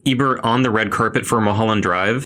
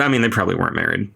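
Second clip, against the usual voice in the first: usual speaking voice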